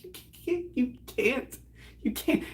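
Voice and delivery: high, soft, breathless stammer; choked with laughter. Retold or imitated. Teller recalls protesting the jokes, helpless with laughter. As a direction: squeeze it out through laughter. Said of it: "I was laughing so fucking hard".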